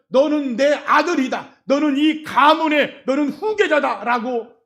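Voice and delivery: loud, slow, ringing declaration; father's joyful proclamation. Acted. Father proclaiming to the returned son, loud and slow. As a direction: proclaim it like a father welcoming his son home.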